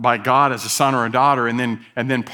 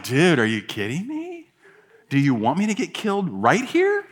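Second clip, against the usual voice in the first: mock disbelief; 3 semitones higher than usual. Retelling his reply to brother's suggestion.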